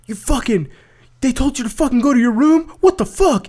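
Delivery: mock outraged, high-pitched rant; imitated angry rant. Angry inmate to his girlfriend on the phone, high mock rage. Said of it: "this guy was angry about that".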